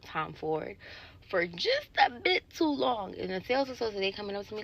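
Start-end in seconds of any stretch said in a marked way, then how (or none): none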